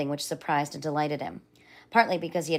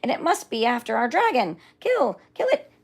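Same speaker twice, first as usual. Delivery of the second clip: high, loud furious mob cries; angry animal yelling; 9 semitones higher, 3 dB louder than usual. Acted. Jungle animals yelling at the invader, high and furious. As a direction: rouse the jungle to kill. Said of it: "all yelling".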